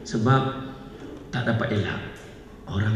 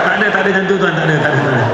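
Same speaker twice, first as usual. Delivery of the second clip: loud dismissal; 2 semitones higher, 6 dB louder, much faster than usual. Pretend. Loudly waving it off.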